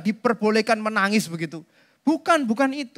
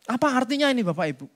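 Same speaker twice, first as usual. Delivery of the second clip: ordinary talk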